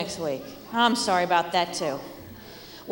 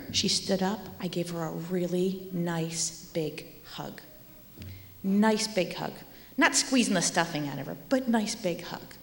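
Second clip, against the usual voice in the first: ordinary delivery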